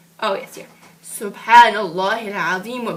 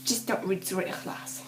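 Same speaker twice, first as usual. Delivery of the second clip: usual speaking voice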